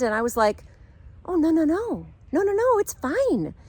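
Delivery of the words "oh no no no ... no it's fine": high, rapid string of refusals; insistent reassurance. Retold or imitated. Traveler reassures crying newlywed, high-pitched insistence. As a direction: wave away her worry in a high voice.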